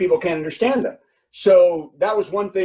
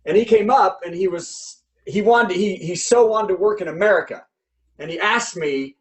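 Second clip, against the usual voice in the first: ordinary talk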